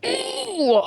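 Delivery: high, squeaky ew of disgust; recoiling at an embarrassing scene. Real, not acted. Cringing at the scene.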